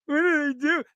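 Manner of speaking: mock a wife's hurt protest; imitating his wife. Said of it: "You sound like my wife".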